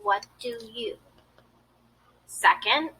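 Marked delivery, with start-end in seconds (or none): 0.1-1.0 "What do you?" with t held and joined to do; softly linked demo; linked consonants. Shown as a demonstration. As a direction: connect the consonants. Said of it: "and say".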